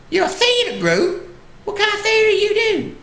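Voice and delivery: very high-pitched Southern granny questioning; Southern old lady. Retold or imitated. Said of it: "sweet little old lady".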